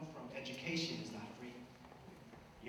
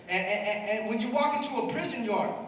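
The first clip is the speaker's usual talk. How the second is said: put-on louder, higher, rousing speech to the kids; preaching at full force; 8 semitones higher, 11 dB louder than usual. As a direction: fire up the young crowd, building. Preaching to young audience.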